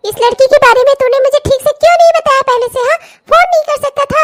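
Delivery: high, fast exasperated telling-off; exasperated rebuke. Acted. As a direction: pelt him with questions, high and fast. Mother rebukes son.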